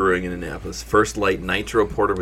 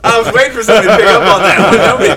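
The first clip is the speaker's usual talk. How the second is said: much higher and louder, fast; exasperated outburst; 12 semitones higher, 12 dB louder, faster than usual. Real, not acted. Host complaining nobody picked up his joke, loud and fast. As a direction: protest that nobody caught your setup.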